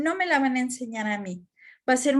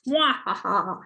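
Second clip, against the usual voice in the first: short amused chuckle; brief playful laugh; light laugh; 4 semitones lower than usual. Real, not acted. Aside about extra homework. (risa breve)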